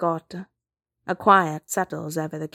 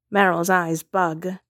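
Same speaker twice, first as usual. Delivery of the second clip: plain reading voice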